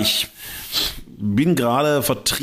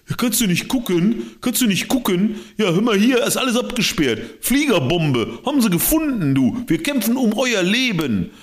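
much higher, mock indignant guard voice; scolding official; 11 semitones higher than usual. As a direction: scold like a pompous officer, pitched up. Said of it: "official scolding in story". (höherer, empört gespielter Ordnungshüter)

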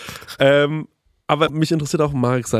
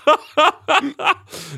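laugh; 12 semitones higher than usual. Real, not acted. Laughs at own rant.

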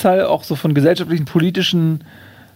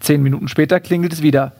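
ordinary talk

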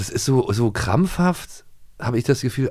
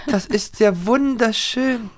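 mock Lisa voice; 12 semitones higher, slower than usual. Mocking the grown-up Lisa voice.

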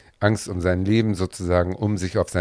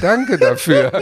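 laughing through a thank-you; 6 dB louder, slower than usual. Real, not acted. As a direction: say it while laughing. Thanks after being talked round.

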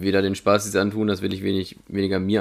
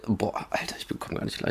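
whispered; 8 dB quieter than usual. Real, not acted. Groaning at himself, whispered.

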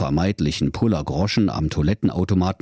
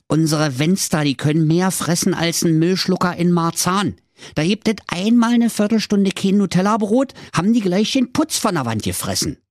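high-pitched grumbling Berlin father; exasperated rant; 8 semitones higher than usual. Acted. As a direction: complain in rising exasperation about the kids. Worried husband rants about greedy children.

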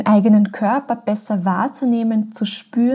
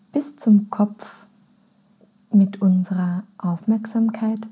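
guided meditation. Acted. Podcast host leads listeners into a body scan, calm and unhurried. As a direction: guide them gently inward, phrase by phrase.

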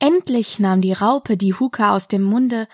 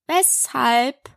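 drawn-out, higher one-word question; caterpillar; 4 semitones higher than usual. Acted. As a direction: pull the two syllables apart. Caterpillar questions Alice curtly.

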